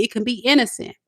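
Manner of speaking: ordinary talk, nothing marked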